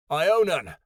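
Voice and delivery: high, loud shout of a name; loud, alarmed call. A narrator's reading. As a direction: shout for a friend lost in the dark. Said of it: "Kyoto shouted in the dark".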